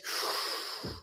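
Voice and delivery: long, soft breath with no voice; slow audible breathing demonstration; deep demonstrative breath. Shown as a demonstration. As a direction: show a full lungful of air. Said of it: "sit up straight like this".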